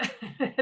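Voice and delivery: a quick amused laugh; short laugh. Real, not acted. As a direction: let out a brief chuckle. Laughs at the silence.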